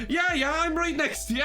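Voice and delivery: high-pitched mock drunk Irishman; high, insistent drunk stranger impression. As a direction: imitate an over-familiar drunk, pitched right up. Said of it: "the Irish guy was completely drunk".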